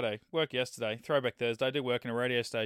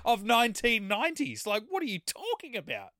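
mock outrage; 8 semitones higher, 3 dB louder than usual. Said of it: "And I'm like".

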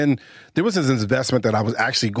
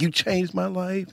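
tender vow; 7 semitones higher, 5 dB quieter, slower than usual. Retold or imitated. Groom's vow quoted tenderly.

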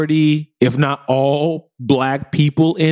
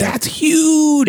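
excited; 13 semitones higher than usual. Real, not acted. Excited emphasis.

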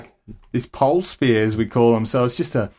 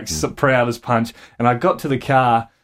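normal talking voice

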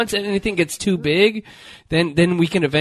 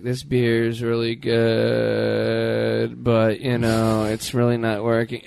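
vocal fry; 7 semitones lower, much slower than usual. Retold or imitated. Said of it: "you'd be like".

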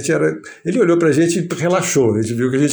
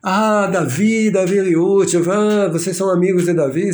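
put-on Portuguese with a porteño accent; 6 semitones higher than usual. Cortázar delighted to hear his friend's name, porteño accent. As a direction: lilt it like a Buenos Aires native speaking Portuguese. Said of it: "Sotaque, assim, portenho".

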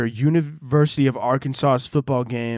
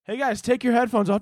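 friendly; 8 semitones higher than usual. Retold or imitated. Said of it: "He's like".

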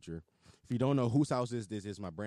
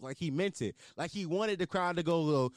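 ordinary talk, nothing marked

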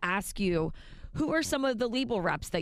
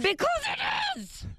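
high, drawn-out cry of disbelief; exasperated yell at a clueless co-host; exasperated outburst; slower than usual. Real, not acted. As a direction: throw your hands up at him, slowed and pitched way up.

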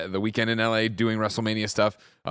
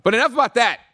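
abrupt switch; 9 dB louder than usual. Acted. Host snapping back to show.

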